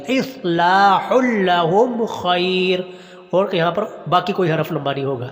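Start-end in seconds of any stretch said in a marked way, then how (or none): 0.1-2.8 slow chant of اصلاح لہم خیر; لہم stressed with a doubled لام; careful recitation. Shown as a demonstration. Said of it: "اچھی طرح اس کے اوپر مشق کیجیے گا".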